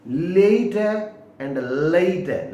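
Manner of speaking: लेट said slowly with a clear "ay" glide; slow careful late. Shown as a demonstration. Teacher showing students, slow clear लेट. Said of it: "देखो लेट है".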